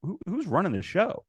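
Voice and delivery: mildly mock outrage at the boss; retold upset protest. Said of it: "I got upset".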